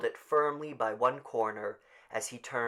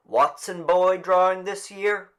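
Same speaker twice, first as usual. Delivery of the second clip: high, louder call across the crowd; raised official call; 9 semitones higher, 11 dB louder than usual. Acted. Official calling out.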